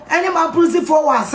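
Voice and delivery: ordinary talk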